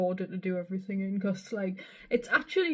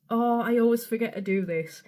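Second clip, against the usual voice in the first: ordinary delivery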